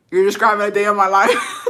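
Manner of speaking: real laugh of recognition. Real, not acted.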